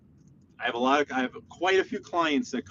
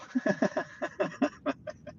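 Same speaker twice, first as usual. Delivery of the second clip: soft, breath-only giggling run; laugh; 6 semitones higher, 8 dB quieter than usual. Real, not acted. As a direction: let out a long quiet chuckle. Laughs at question about going live.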